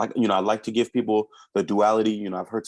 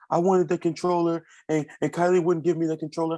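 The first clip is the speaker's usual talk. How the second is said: put-on higher, quick whiny child complaint; child complaining; 6 semitones higher than usual. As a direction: whine like a kid about the controller. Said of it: "Then he'll come back".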